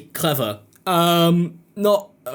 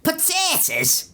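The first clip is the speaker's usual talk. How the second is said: high, drawn-out creature squeal; Gollum; much slower than usual. Acted. Creature spotting Sam with food, high and drawn-out impression. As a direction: stretch it out in a very high, wretched voice. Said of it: "It's like when Gollum sees Sam and he goes".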